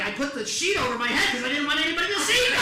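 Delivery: hammed-up mother's excuse; worked-up elderly mother. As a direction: rattle it off indignantly as Mom. Voices mother's explanation, building.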